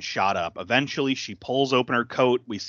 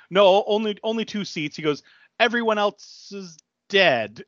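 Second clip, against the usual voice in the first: mock villain; 9 semitones higher than usual. Villain's line retold.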